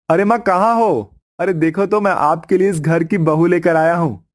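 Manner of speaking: shouting. Acted. Son shouting for his mother to show his new bride. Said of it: "इतनी जोर से क्यों चिल्ला रहा है".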